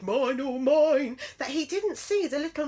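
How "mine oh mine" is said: muttering bear. A narrator's reading. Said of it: "as he muttered".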